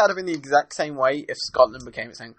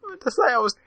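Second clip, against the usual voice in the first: high-pitched, laughing through words. Real, not acted. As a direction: giggle it out. Cracking up at own mistake.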